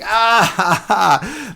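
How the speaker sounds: real laugh breaking into words. Real, not acted. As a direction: laugh out at the clever ending. Laughs at the story's ending.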